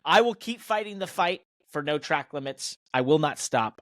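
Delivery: ordinary delivery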